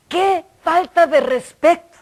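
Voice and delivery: loud, high mock scolding; imitated scolding shout. Older church server scolding a visitor, mock shout. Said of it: "no sé por qué me gritó".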